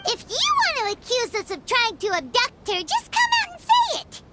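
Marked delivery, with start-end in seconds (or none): 2.8-4.2 sudden high, sharp cry of protest; indignant outburst. Acted. Paimon shouting back at the suspicious guard, indignant. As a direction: fire it back, offended and shrill.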